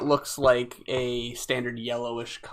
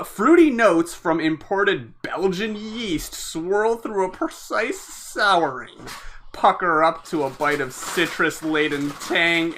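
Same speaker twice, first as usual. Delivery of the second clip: dramatic label read; 3 semitones higher, 4 dB louder, slower than usual. Acted. Reads label description.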